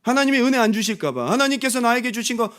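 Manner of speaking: loud, high preaching. Acted. Pastor pressing his congregation, loud and high.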